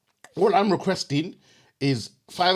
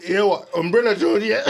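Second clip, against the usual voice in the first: laughing; 5 semitones higher, 5 dB louder than usual. Real, not acted. Laughing outburst.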